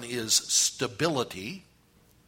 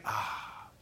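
long breathy "ahh"; relieved sigh; 3 semitones lower, 7 dB quieter than usual. Acted. Preacher acts reaching a desert shade tree, relieved sigh. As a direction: let out a soft, airy sigh of relief.

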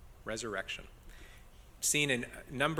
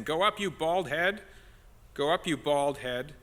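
loud read-aloud taunt, pitched up at first; a touch of mockery in a projected voice; mild jeer; 4 semitones higher, 4 dB louder than usual. A narrator's reading. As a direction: read it as boys lightly jeering. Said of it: "jeered at him".

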